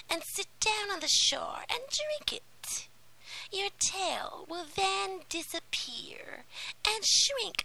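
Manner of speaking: high, whispery menacing witch; a crooning sea witch, sly and cruel. Acted. Sea witch explains the price.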